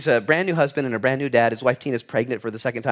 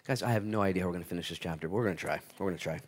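much softer, quick aside; quiet aside; 8 semitones lower, 11 dB quieter, faster than usual. Real, not acted. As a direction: mutter it to the room. Aside about time.

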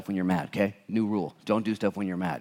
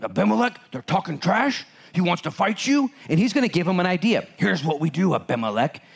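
scheming adviser; 6 semitones higher than usual. Acted. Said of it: "It's like".

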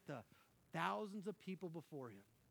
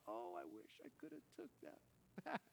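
much higher, softer regretful whine; mock regret; 11 semitones higher, 6 dB quieter than usual. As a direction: whine like you wish you could undo it. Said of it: "And you're like".

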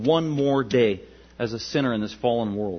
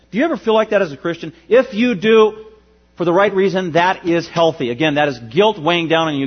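louder, higher preaching voice, pressing each point; full-force preaching; 3 semitones higher, 5 dB louder than usual. Acted.

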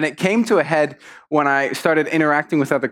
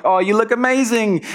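high-pitched, over-sweet compliment; gushing polite praise; 8 semitones higher than usual. Acted. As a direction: gush along with everyone else. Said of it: "I'm there, and I'm like".

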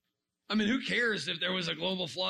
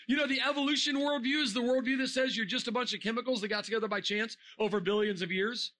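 ordinary talk, nothing marked

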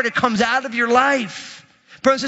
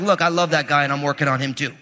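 lightly voiced God; 7 semitones lower than usual. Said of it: "God's like".

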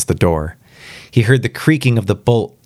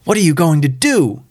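ordinary delivery

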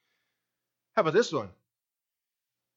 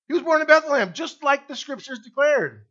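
ringing out high and strong from the pulpit; loud proclamation; 6 semitones higher, 5 dB louder than usual. Real, not acted. Preacher to the congregation, loud proclaiming. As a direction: declare it like a triumphant truth.

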